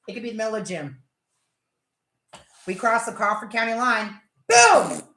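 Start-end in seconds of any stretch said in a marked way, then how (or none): 4.5-5.0 loud, high burst; sudden boom. Acted. Storyteller at the county line, exclaiming loudly.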